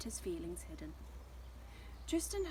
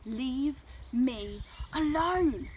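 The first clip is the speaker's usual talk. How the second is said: slow, loud storybook scream; angry outburst, drawn out; 4 semitones higher, 8 dB louder, much slower than usual. A narrator's reading. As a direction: shout it word by word, building to the last. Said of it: "Tristan screamed".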